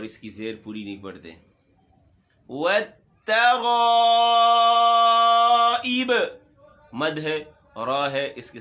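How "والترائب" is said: louder, slow chant of والترائب; tajweed recitation. Shown as a demonstration. Teacher recites والترائب for the class, louder chant. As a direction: stretch the madd and sing it out. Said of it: "اس الف کو بھی موٹا پڑھنا ہے".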